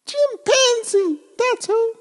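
very high, fussy little bird voice. Acted. Oxpecker telling her love about the banana peel, high and fussy. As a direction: play the upset little oxpecker.

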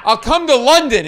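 loud, high, over-eager offer; high-pitched nervous outburst; flustered blurt. Retold or imitated. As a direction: blurt it out in a starstruck panic.

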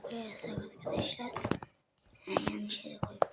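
hushed whisper to viewers. Real, not acted. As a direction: whisper it under your breath. Whispering to viewers mid-build.

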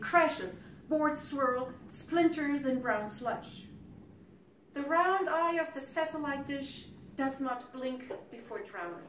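forceful, measured poetry recital; poem reading. Acted. Poet recounts a house swept away by flood, forceful recital.

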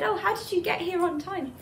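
high-pitched storyteller's astonishment; surprised. Retold or imitated. Pundit to the little girl at his door, surprised. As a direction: act out being taken aback, voice lifted high. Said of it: "he was surprised to see".

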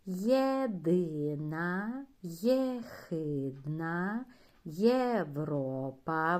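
Єдина, єхидна, Європа drawn out slowly; slow model reading for children; slow syllable reading. Shown as a demonstration. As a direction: read each word syllable by syllable. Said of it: "Спробуємо прочитати слова".

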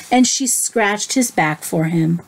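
ordinary delivery

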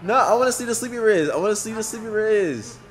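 high, loud eager pleading; excited demand. Real, not acted. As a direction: beg loudly with excitement.

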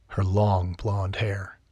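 ordinary talk, nothing marked

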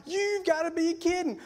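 high, incredulous exclamation; mock disbelief. Boyfriend to cheating girlfriend, high mock disbelief. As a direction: pitch it way up, playing the stunned boyfriend.

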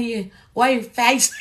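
ordinary talk

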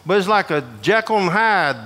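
ordinary talk, nothing marked

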